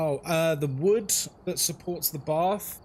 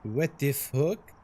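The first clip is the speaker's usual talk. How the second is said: drawn-out French-style what the fuck; lightly mocked French accent; slow French-accented what the fuck; slower than usual. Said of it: "how the French would say".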